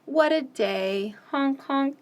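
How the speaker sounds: ordinary talk, nothing marked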